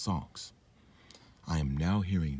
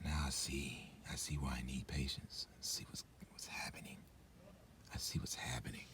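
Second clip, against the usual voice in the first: hushed unvoiced whisper; 3 semitones lower, 13 dB quieter than usual. Real, not acted. Realising it, whispering to viewers. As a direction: whisper as it dawns on you.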